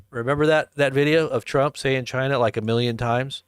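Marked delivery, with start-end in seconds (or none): none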